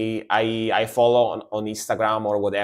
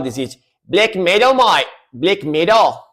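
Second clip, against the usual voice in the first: higher-pitched mock Australian accent; imitated Australian accent; 5 semitones higher than usual. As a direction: put on a lively Aussie accent. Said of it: "I try to imitate a lot".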